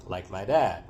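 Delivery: ordinary delivery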